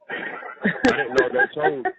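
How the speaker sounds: high breathless laugh through the words; words squeezed out mid-laugh. Real, not acted. Husband laughing to his wife on the phone, giggling. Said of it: "You laughing at me?".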